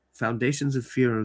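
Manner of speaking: ordinary delivery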